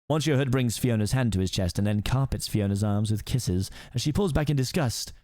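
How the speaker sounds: usual speaking voice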